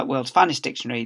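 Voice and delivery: ordinary delivery, nothing marked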